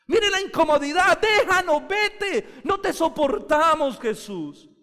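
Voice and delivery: loud, high, slow déjanos; preacher voicing the uncomfortable demon begging Jesus to leave; demon pleading. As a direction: beg and howl as a demon. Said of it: "Lucas dice que el demonio dijo".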